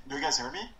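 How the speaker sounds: ordinary talk, nothing marked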